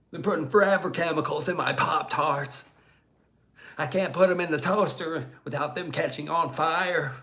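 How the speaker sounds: hammed-up rant about Pop-Tart chemicals; RFK impression. Streamer doing the requested RFK Pop-Tart bit, strained mock rant.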